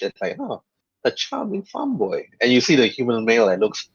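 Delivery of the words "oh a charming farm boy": slow, higher-pitched, softly flattered; flustered. Acted. Card player charmed by a farm boy's compliment, mildly flustered. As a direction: play a touch of blushing fluster. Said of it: "flustered, and she, like, blushed".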